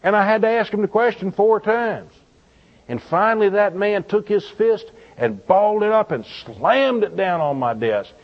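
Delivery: ordinary talk, nothing marked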